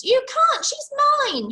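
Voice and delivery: very high, loud roar. Acted. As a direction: roar him off. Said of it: "he roared".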